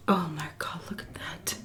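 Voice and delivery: soft hushed whisper; whispered awe. Real, not acted. To viewers about the food, hushed whisper. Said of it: "food reaction".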